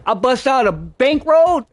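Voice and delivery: ordinary talk, nothing marked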